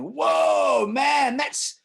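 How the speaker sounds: doctor losing it over a swollen leg, alarmed shout; over-the-top alarm. Retold or imitated. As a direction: gasp and gush like a panicking doctor. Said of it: "that's a crazy emotional response".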